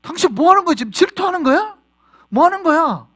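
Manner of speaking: loud, high accusing shout; shrill-free jealous yelling at a spouse; jealous outburst. Acted. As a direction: confront your partner in a jealous fury. Acted jealous spouse confronting partner.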